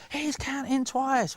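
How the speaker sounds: put-on slow, high kids' call; drawn-out chant of children in the audience; kids calling out. Children in the audience call out to Idle Jack, slow and high. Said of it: "And the kids will go".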